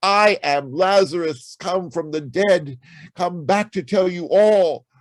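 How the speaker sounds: a hint of a risen man proclaiming, held high and loud to the last word; rising Lazarus proclamation. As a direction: announce it as the risen Lazarus, climbing to a cry. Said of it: "to say".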